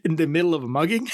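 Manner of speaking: high, fast disbelieving question; mock incredulous. Host recalls his reaction to the mugging scene, mock incredulous.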